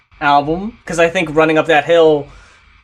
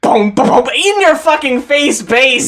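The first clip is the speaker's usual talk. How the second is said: higher and louder, hyped about the bass; excited rave; 9 semitones higher, 3 dB louder than usual. Real, not acted. Praising the bass on Big Sky, excited and loud. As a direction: gush like the bass just hit you.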